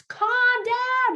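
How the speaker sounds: high, loud mock yell of calm down; yelling parent. Parent yelling at an upset child, a high mock shout. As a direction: play the frazzled parent shouting it, ironically.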